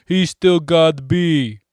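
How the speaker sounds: loud, slow shout; hollering down to the Death Eaters. Acted. Neville shouting down to back up Harry.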